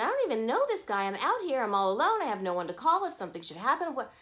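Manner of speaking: ordinary talk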